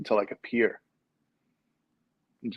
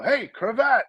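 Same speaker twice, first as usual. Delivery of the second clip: pitched-up holler from a car; calling out; 11 semitones higher, slower than usual. Retold or imitated. Driver hails his friend from the car, calling out. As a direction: call out the window, pitched high. I rolled the window down.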